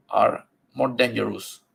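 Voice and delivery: ordinary talk, nothing marked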